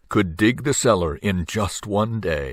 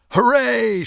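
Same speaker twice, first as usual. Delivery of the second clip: high, joyful shout; crowd cheer; 4 dB louder than usual. A narrator's reading. Townspeople cheering the finished cellar, high and loud. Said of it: "shouted the people".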